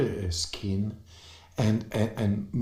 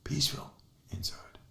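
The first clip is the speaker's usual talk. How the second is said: soft, whispered sense of peace; hushed calm; 4 semitones higher, 10 dB quieter, faster than usual. Retold or imitated. Acting out sudden calm after panic.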